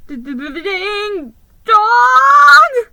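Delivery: high, loud stuttering shout; stammered. Acted. Airsick mouse stammering at the elf. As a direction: stammer the name in fright. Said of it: "I stammered".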